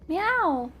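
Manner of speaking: high meow; playful meow. Pretend. Owner meowing back at his cat, playful. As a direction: mimic a cat.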